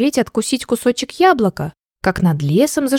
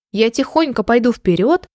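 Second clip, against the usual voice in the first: usual speaking voice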